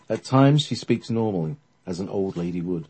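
ordinary delivery, nothing marked

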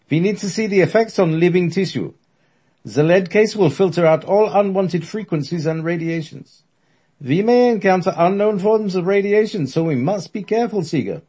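high, loud German lecture; German accent. Acted. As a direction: hold the thick German accent. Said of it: "In his thick German accent".

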